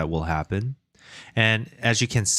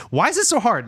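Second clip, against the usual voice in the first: high, loud exasperated cry; frustrated outburst; 13 semitones higher, 6 dB louder than usual. Real, not acted. Frustrated at coding problem.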